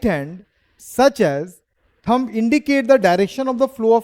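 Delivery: slow, spaced-out recital of the rule, word by word; slow dictated definition. Shown as a demonstration. Teacher reciting the rule slowly to students who must memorise it, slow and deliberate. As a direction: spell the definition out slowly so the class can follow. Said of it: "teacher demonstrates reciting the definition".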